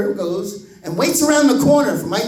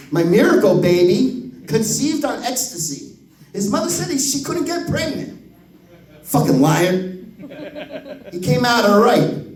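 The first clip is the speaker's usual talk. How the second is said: ordinary talk, nothing marked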